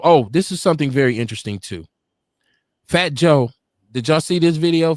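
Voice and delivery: normal talking voice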